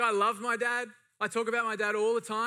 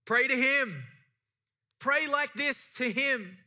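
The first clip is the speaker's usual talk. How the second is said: urging; 6 semitones higher, 4 dB louder, slower than usual. Acted. Preacher urging the church.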